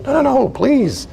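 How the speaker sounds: high, drawn-out plea; earnest, insistent protest; acted pleading. Acted counselor urging client to cry.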